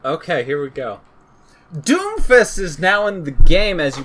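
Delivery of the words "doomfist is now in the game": excited announcement. Real, not acted. Hyping up the big announcement.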